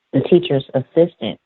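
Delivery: ordinary delivery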